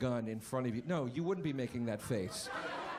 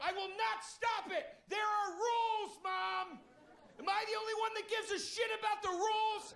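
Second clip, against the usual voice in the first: much higher, shouted righteous rant about rules; John Goodman rant. Retold or imitated. Comic yelling at his mom in the parking lot, shouted rant. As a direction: rage like a bowling-alley zealot. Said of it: "doing the John Goodman speech".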